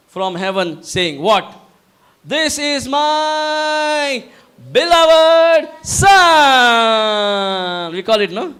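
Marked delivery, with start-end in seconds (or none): 2.2-7.9 very slow, much higher, ending in a loud shout; wrestling-arena introduction stretched into a roar; WWF ring announcer. Acted. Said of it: "with the same accent".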